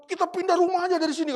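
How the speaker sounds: high, loud retold outburst; resentful outburst. Resentful mother telling her family to move house, indignant outburst. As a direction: act out a mother's indignant demand. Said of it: "mama saya tuh dendam sekali".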